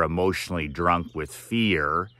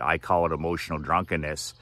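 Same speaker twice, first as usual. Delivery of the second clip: ordinary talk, nothing marked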